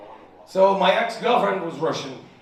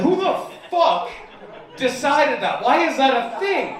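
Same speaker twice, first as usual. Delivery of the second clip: outraged disbelief; 7 semitones higher than usual. Retold or imitated. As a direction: rant at the absurdity, laughing. Outraged at the phrase's meaning.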